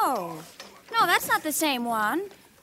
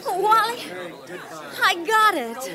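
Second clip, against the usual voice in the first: high, thrilled; excited; 7 semitones higher, slower than usual. Acted. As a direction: burst out with joy. Wife thrilled with the purchase.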